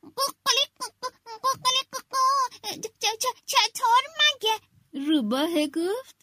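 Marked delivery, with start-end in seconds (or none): 0.0-4.6 high, quick rooster voice that stammers at the end; a proud little rooster, nervous by the last words. Acted. Rooster answering the fox, high and stammering. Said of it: "خروسه گفت".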